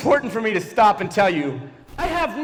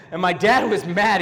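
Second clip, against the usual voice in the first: ordinary talk, nothing marked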